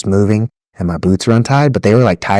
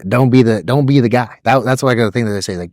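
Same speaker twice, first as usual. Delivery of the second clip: ordinary talk, nothing marked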